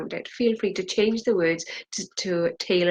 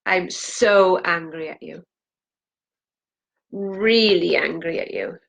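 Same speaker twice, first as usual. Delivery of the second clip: slow angry; 4 dB louder, slower than usual. Acted. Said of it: "feel free to put as much emotion".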